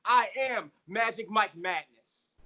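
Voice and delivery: loud high ring announcer; grand entrance announcement. Pretend. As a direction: announce yourself to the arena. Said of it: "let me reintroduce myself".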